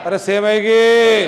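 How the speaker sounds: loud, drawn-out call for an answer; insistent prompting. Real, not acted. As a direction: call it out slowly to rouse a silent class. Pressing silent class to answer. (ज़ोर से, खींचकर जवाब माँगते हुए)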